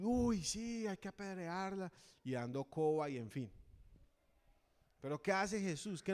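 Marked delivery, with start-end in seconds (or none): 0.0-1.9 multitud acusadora. Retold or imitated. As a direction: egg on the stoning like a gossiping mob. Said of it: "Y yo me imagino la multitud". (multitud acusadora, voz más aguda y fuerte)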